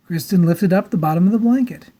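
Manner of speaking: ordinary delivery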